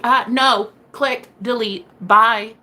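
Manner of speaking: snappy mock dismissal; dismissive. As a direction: shut him down flat. Dismissing the ex's plea.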